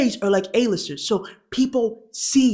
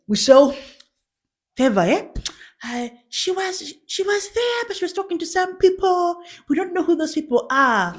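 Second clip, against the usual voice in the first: high-pitched Ugandan tattletale; Ugandan accent; 9 semitones higher than usual. Acted. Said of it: "I'm doing, like, the Ugandan accent".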